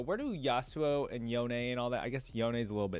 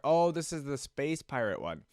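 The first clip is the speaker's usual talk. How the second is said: ordinary delivery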